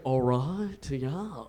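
put-on low, half-hearted British accent; a hesitant stab at a British accent; bad British accent. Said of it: "I don't do a British accent".

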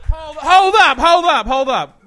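loud, high, slowing hold up; shouted interruption. Acted. Bro halting his buddy, shouting. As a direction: yell it to stop everything.

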